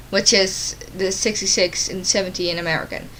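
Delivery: usual speaking voice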